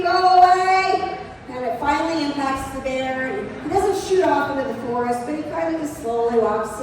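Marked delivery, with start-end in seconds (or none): 0.0-1.2 louder, higher and slow drawn-out go away; retold scream. Standing on the bench to look big, a slow drawn-out yell at the bear. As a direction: stretch the yell out big and imposing. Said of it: "and I scream".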